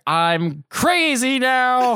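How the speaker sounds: high, drawn-out mad cry; hammed-up craziness. Banker cracking up in front of the board, gleefully crazy. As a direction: stretch it out like a man losing it.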